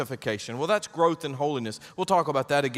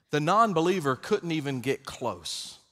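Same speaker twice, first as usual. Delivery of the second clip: ordinary talk, nothing marked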